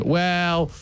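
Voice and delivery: lightly put-on American manager voice; mocking the American manager's upbeat interview; American accent. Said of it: "And then Jesse Marsch comes in".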